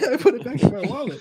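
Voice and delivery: high, laughing through words. Real, not acted. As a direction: crack up mid-sentence. Laughing at own story.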